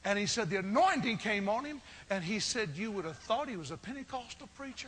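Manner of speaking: high, loud revival preaching, building up; full-force preaching. Acted.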